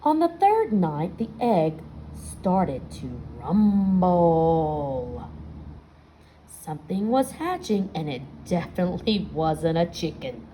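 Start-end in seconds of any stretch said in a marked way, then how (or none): 1.2-5.3 drawn out slowly for suspense. A narrator's reading. Reading to kids, slow spooky suspense. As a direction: stretch it out, build the tension.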